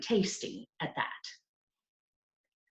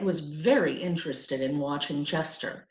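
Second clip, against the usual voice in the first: usual speaking voice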